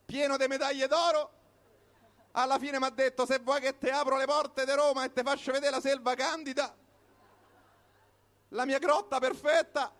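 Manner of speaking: ordinary talk, nothing marked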